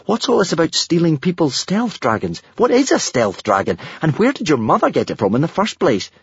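put-on a boy losing control of events; a hint of an alarmed boy. A narrator's reading. Hiccup alarmed questioning.

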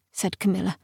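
usual speaking voice

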